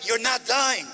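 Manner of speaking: high, forceful cry; retold shout. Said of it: "When she shouted".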